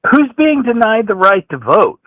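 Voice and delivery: usual speaking voice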